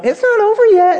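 high, bright-sounding mock of a hopeful wife; upbeat wife. Ever-positive wife reassuring her sulking husband, voiced high. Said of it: "So she's going".

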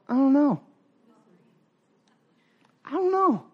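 high-pitched imitation of a clueless kid; disobedient kid. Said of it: "your kid has ever disobeyed you".

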